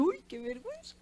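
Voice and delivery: small, hushed embarrassed reaction; mock embarrassment. Speaker reacting to soot on the face, embarrassed. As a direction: say it softly, as if caught out.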